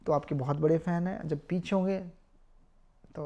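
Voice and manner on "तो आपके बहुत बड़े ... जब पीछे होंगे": ordinary talk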